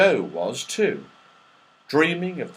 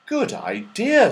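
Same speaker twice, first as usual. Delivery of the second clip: Joe approving; 10 semitones higher, slower than usual. Acted. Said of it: "said Joe".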